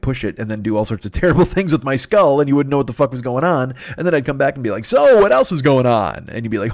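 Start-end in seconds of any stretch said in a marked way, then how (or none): 4.8-6.3 clear voice. Pretend. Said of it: "he sounds different and clear".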